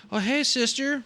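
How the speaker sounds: very high mock-sweet coworker voice; fake-friendly greeting. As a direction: imitate a two-faced coworker's high greeting. Mimics two-faced coworker greeting.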